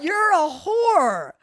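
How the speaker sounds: high, drawn-out yelled insult; imitated screaming. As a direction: mimic the man's stretched-out yell. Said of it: "screaming like that".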